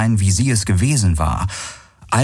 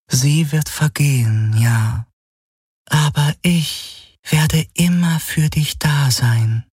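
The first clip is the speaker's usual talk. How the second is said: higher, slowing, devoted female figure voice; tender shadow apparition, promising; 7 semitones higher, slower than usual. Acted. Illusion assures Galen of her devotion, tenderly.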